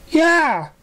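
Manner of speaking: very high excited pony yelp. Acted. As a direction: bounce it out with delight. Character exclaims in story.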